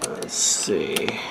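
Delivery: whispered under the breath while tuning; barely audible, thinking aloud; muttered to self. Real, not acted. Tuning a radio alone, whispering to himself.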